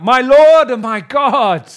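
loud, slow cry of faith; shouted exclamation. Acted. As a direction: call it out in awe. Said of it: "He shouts out".

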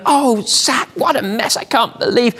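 put-on a fed-up wife at a messy child; exasperated wife. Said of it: "she'd be going".

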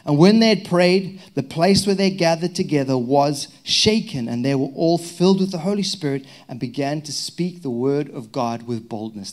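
loud, rising reading of the passage; scripture reading. Acted. Pastor reading Acts to the congregation, loud and bold. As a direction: proclaim the verse boldly.

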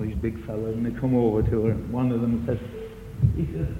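ordinary delivery

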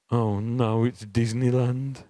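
put-on slow, offhand boy voice; cool ten-year-old. Ten-year-old son pretending not to be excited, slow and offhand. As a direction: play it cool while hiding the thrill. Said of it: "now he's ten years old and cool".

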